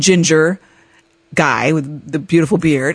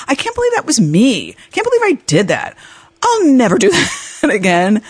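disbelief; 8 semitones higher than usual. Retold or imitated. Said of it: "And I'm like".

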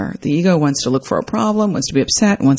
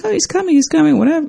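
breezy dismissal; 11 semitones higher, faster than usual. Retold or imitated. Breezy answer to guests.

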